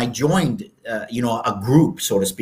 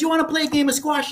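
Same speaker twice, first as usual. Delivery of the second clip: professor's invitation; 13 semitones higher, 3 dB quieter, faster than usual. Retold or imitated. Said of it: "He goes".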